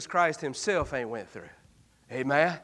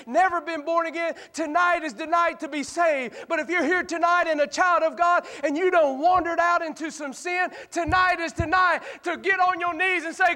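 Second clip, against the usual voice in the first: higher, full-force preaching; preaching altar call; 12 semitones higher than usual. Acted. Preacher urging sinners to repent tonight, full force. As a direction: press the lost to come tonight.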